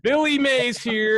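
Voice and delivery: high, stretched pitchman call; slow infomercial catchphrase shout; Billy Mays. Acted. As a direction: announce yourself like a TV salesman. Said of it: "Who the fuck is Billy Mays?".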